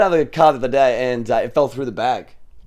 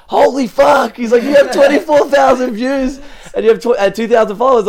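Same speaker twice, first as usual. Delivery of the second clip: much higher and louder, slow stunned disbelief; amazed shout; 9 semitones higher, 4 dB louder than usual. Retold or imitated. Fan spotting a streamer at the store, high amazed shout. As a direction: gasp it out like a starstruck fan. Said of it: "and he's like".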